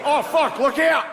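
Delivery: high, alarmed Australian shout; Australian accent. Acted. Australian guy in the office during an attack, alarmed Australian accent. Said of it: "an Australian guy in the office".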